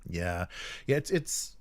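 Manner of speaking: normal talking voice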